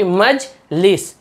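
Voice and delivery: "Majlis" split in two, मज, pause, लिस; broken into syllables. Shown as a demonstration. Teacher showing a spelling split, "Majlis" broken apart. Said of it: "टूट टूट के पढ़ा रहा".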